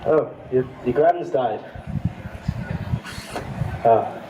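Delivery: low-pitched, subdued condolence; mock sympathy. Friend hearing of a death during a catch-up, low mock sympathy. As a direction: drop the voice for bad news.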